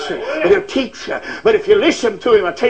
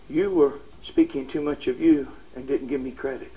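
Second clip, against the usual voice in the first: much lower and softer, a gentle inner voice; still small voice; 9 semitones lower, 9 dB quieter than usual. Acted. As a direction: hush it like God speaking within. Quoting God's still small voice.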